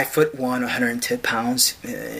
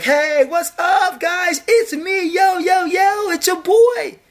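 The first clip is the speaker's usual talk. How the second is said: high, slow, loud dude greeting; high drawn-out yo yo mockery; mock Jesus bro voice; 5 dB louder, slower than usual. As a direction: stretch it out like a cocky homeboy.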